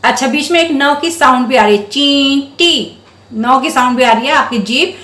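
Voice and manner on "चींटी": slow, stretched चींटी with a long nasal न; drawn-out word. Shown as a demonstration. Teacher to children, stretching चींटी slowly to show the nasal. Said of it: "थोड़ा sound को लंबा कर रही हूँ".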